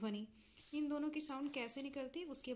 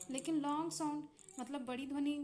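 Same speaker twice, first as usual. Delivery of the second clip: usual speaking voice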